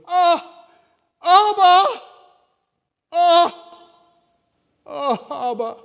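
high, loud wailing Abba; loud high wailing cry of Abba; anguished wail. Shown as a demonstration. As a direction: cry out in agony to the Father. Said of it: "It was".

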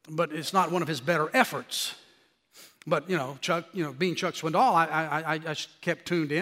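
ordinary talk

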